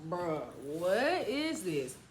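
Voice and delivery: low and very slow, dazed; woozy daze. Retold or imitated. Tipsy girl reacts to the shot, in a slow, low, dazed voice. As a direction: drag it out as if half drunk.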